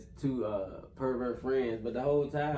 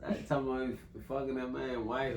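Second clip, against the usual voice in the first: ordinary delivery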